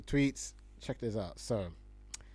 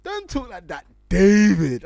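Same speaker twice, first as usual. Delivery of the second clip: hammed-up offended reply, pitched up; mock offended voice; 7 semitones higher, 15 dB louder than usual. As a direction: act out an offended stranger. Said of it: "He went".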